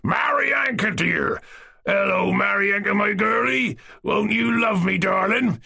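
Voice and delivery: put-on high bellowing call to the girl; old man calling to a passing girl through the window, bellowing; bellowed. A narrator's reading. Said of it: "He coughed and bellowed".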